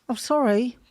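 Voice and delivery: normal talking voice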